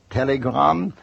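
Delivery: mild mock call through the door; a lightly called telegram; yelled. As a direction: call out the word like a porter. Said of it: "somebody yelled".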